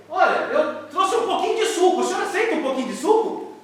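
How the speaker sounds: put-on high, eager little boy voice; small boy's voice. A boy offering the old man his sandwich, high and eager. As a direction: play a shy kid offering food.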